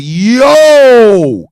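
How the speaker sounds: long, loud, drawn-out amazed cry; amazed exclamation. Retold or imitated. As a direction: stretch it out loudly in amazement. Amazed reaction to the earnings.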